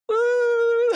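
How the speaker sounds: sharp gasp, then a high held woo; imitated crowd reaction. Commentator imitating people's big reaction to an entrance, gasping and whooping. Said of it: "what you get is people going".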